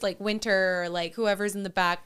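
ordinary talk

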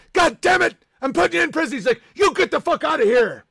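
hammed-up raging bad cop; bad cop yelling. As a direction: scream at the suspect.

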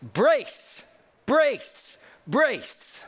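high, punched single-word commands; acted cabin-crew alarm; crash warning call. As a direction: call it out like an emergency order, a beat between each. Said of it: "he said he heard these words".

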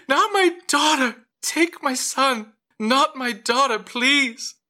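high, loud pleading cry; desperate mother. Acted. Mother begging a patron to spare her daughter, desperate. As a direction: beg frantically in a high voice. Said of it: "And the woman is".

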